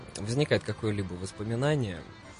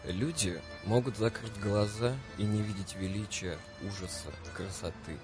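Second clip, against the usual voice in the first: dramatic literary reading; 6 dB quieter than usual. Acted. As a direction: read it like a radio poem. Reads Süskind quote over music.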